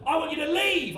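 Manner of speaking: high-pitched mock yell; angry dad. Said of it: "we're just yelling in each other's faces".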